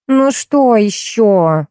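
exasperated exclamation. A narrator's reading. Actress snaps at her new make-up artist, exasperated exclamation. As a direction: draw it out, fed up with the interruption. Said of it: "воскликнула Софья".

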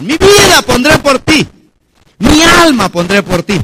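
loud, slow impassioned vow; fervent promise. Retold or imitated. Believers promise their lives to the Lord, loud and fervent. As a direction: pledge it grandly, imitating believers.

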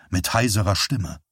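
ordinary delivery

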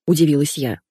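usual speaking voice

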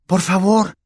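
put-on high, loud, urgent boy's plea; desperate plea. A narrator's reading. Boy begs the noisy elf to hush, desperate. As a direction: beg desperately, pitched right up. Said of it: "susurró Harry desesperado".

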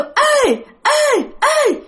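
very high, ringing cry; pig with girl's voice. Retold or imitated. Giant pig in the tree calls down, high girlish cry. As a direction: call out high as a demon pig. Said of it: "Mà nó cứ kêu".

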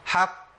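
"حق" with the qaf held long and bounced; strong qalqala. Shown as a demonstration. Teacher showing the highest qalqala rank, emphatic. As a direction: hold the final qaf of "حق" longer. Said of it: "بدي زمن زيادة شوية".

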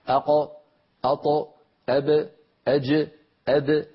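"أق، أط، أب، أج، أد", each bounced lightly; light qalqala syllables. Shown as a demonstration. Teacher drills the letters for students, light bounce. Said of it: "بكل سهولة وبكل يسر".

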